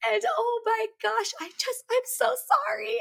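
high-pitched mock blubbering; imitated tearful crying. Wife imitating husband crying on the phone, tearful. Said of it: "he was, like, crying on the phone".

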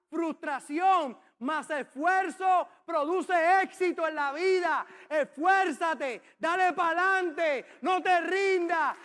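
slow, loud, hammering each word; forceful exhortation. Real, not acted. Preacher urges parents and children to keep going, loud and slow.

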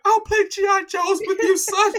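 very high mock mother voice; imitating mom. Mom agreeing to play G I Joes, very high mock voice. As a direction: sing it like a doting mom.

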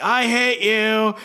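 high, drawn-out mock rage; mock bickering anger. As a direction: stretch it out slowly, exasperated. Body-swapped rivals bickering.

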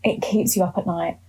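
ordinary delivery

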